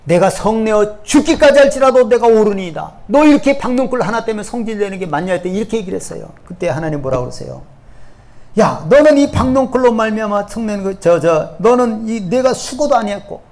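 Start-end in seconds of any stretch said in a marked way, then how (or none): 0.0-5.3 raised retelling. Acted. 요나와 하나님의 말 재연. 8.6-13.4 God scolding. Acted. 하나님의 꾸짖음 재연.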